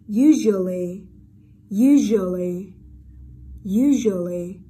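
usually loud and slow, s buzzed as zh; voiced zh. Shown as a demonstration. Teacher repeats for students, usually loud with voiced zh. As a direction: punch out "usually" with a clear zh. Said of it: "chữ S ở đây được đọc là âm".

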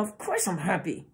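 quiet, tightly held-back insistence; suppressed intensity. Shown as a demonstration. Narcissist hiding true feeling behind the words, restrained intensity. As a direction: keep the volume down with feeling pressing behind it. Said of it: "the intensity behind the words that are coming out".

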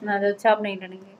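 very soft, low, subdued begging; coaxing a partner to stay, barely raising the voice; quiet pleading. Real, not acted. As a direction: plead in a small hushed voice, with long silences. Wife begs husband not to leave.

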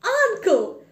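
high, loud delighted hail; crying out. A narrator's reading. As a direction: cry out to welcome an uncle. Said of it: "Fred cried".

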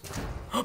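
sudden breathless gasp; sharp gasp. Real, not acted. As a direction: gasp at the reveal. Big unboxing reveal.

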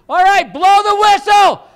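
high, loud holler; calling from afar. Retold or imitated. Wife hollering to husband across a field, high long-distance shout. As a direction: shout across a hundred feet. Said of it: "walks away about a hundred feet".

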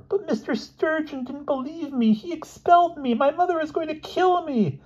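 very high, squeaky lament; small plaintive wail; despairing Elmer. Acted. Expelled boy fearing his mother, high squeaky despair.

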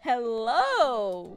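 loud, drawn-out hello; hammed-up greeting. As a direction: play up the neighbor's big hello. Voices the neighbor's first greeting.

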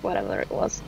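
ordinary talk, nothing marked

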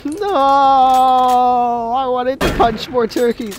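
high, loud whiny protest; mock outrage. Real, not acted. Mock outrage at broken machine.

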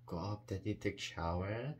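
ordinary talk

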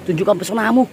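usual speaking voice

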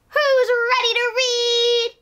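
high, loud invitation to listeners; rally the kids for storytime; excited call-out. Acted. Host asks young listeners if they're ready, excited.

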